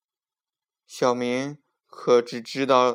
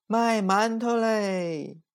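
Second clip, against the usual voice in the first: slow, drawn-out hawker cry; street vendor's call; 9 semitones higher, much slower than usual. A narrator's reading. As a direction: sing out buns for sale. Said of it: "阿姨一边叫唤一边走".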